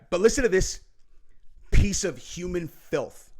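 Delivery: normal talking voice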